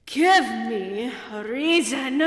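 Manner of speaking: slow commanding repeat; forceful demand. Acted. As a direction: demand it slowly and hard.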